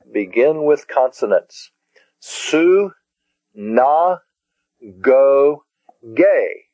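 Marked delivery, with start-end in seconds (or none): 2.2-6.7 Su, na, go, ge as separate syllables with pauses; each syllable of Su, na, go, ge set apart. Shown as a demonstration. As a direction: break it into Su... na... go... ge. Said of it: "that has four syllables also".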